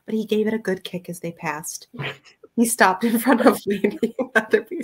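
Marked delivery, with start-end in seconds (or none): 2.6-4.8 laughing while reading. Real, not acted. Reading aloud, breaks into laugh.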